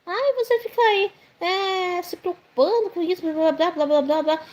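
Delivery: high, mocking imitation of a preachy older man; mocking the judgy guy. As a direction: lightly mimic a condescending uncle lecturing. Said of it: "ficou me julgando".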